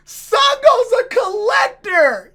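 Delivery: high, slow, emphatic shout; loud high-pitched exclamation, drawn out; excited outburst. Real, not acted. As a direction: shout it as a hilarious revelation. Amused outburst at the reveal.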